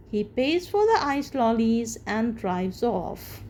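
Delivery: usual speaking voice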